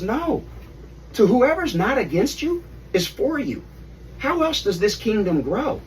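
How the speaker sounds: correcting them with mild mock fervour; emphatic Jesus.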